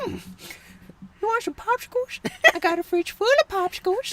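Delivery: put-on very high, soft creepy old man; Herbert the Pervert. Old man offering kids popsicles, high soft creepy voice. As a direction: coax in a thin high old voice. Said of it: "It's the old guy in the cane".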